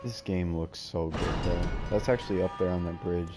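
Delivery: ordinary talk, nothing marked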